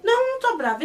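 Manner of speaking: high-pitched defensive protest; acted touchy denial, pitched high; testy denial. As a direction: deny it with mock irritation. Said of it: "você tá bravo na sua voz".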